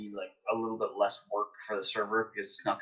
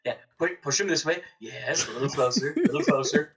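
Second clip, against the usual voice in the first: eager, higher, luring a mob closer; playful coaxing; 5 semitones higher, 4 dB louder than usual. Pretend. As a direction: egg it on, a touch louder.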